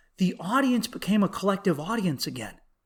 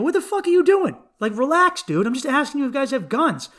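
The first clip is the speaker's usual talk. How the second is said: angry yell; 7 semitones higher, faster than usual. Retold or imitated. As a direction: snap at him to calm down. Confronting heckler at comedy show.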